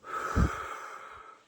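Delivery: long breathy exhale of cigarette smoke. Retold or imitated. Retelling a friend lighting up, imitated smoke exhale. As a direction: blow the smoke out slowly.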